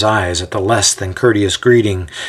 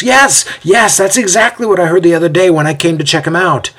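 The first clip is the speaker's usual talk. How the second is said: high, quick, excited agreement; eager interjection; 8 semitones higher than usual. A narrator's reading. Othello confirming what Basil overheard, eagerly interjecting. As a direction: jump in and rush the confirmation. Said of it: "Othello nods his head and interjects".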